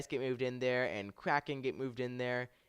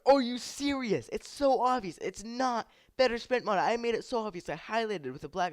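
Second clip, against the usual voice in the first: retold yelling; 11 semitones higher, 6 dB louder than usual. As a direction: shout it like you can't believe them. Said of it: "this is, like, my exact words".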